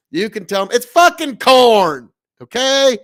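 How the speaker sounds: loud, punchy farmer drawl on fucking corn; Nebraska anger drawl. Shown as a demonstration. Streamer coaching a viewer, loud farmer drawl. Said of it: "please say it as close to exactly like that".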